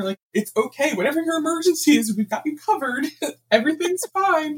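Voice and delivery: put-on calm him down like a frightened child; soothing the panicked customer. Calming the frantic customer.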